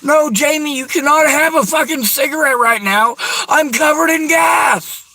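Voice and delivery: yelling at the friend reaching for a lighter; frantic shout. Retold or imitated. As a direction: forbid it at the top of your voice. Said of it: "I see her trying to light".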